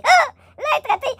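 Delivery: L'être raté shrieked very high; high squeal. Shown as a demonstration.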